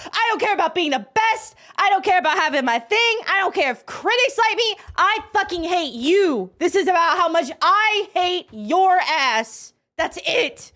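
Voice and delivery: high, gleeful mock tirade; hammed-up hateful rant. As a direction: spit out imitated hatred at a high pitch, relishing it. Repeats hateful paraphrase with relish.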